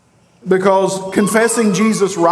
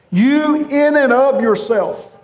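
"You" pitched higher and slow, with weight; emphatic you; 6 semitones higher than usual. Retold or imitated. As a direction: lift and stress "You" at the start. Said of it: "you is emphatic".

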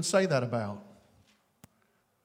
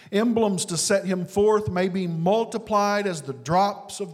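fervent quote reading; 6 semitones higher, 6 dB louder than usual. Acted. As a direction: proclaim it grandly, word by word. Reads Spurgeon quote with force.

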